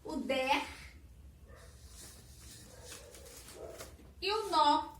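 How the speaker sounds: each syllable alone, with long pauses between. Shown as a demonstration. Teacher building a word in the space below, syllable by syllable. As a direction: call out "K", "der", "nó" separately as you go. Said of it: "Vou pegar a sílaba K".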